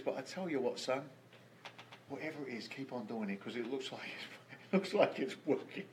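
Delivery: quiet, emotion-choked voice. Real, not acted. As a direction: hold back tears softly. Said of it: "it's gonna choke me up".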